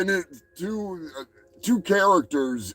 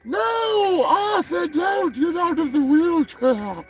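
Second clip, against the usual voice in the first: much higher voice, shouting then drawn out; overdramatic wife; 10 semitones higher, slower than usual. Retold or imitated. Vlogger imitates the wife begging Arthur, high overdramatic shouting. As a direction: wail it like a melodramatic wife. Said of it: "his wife's like".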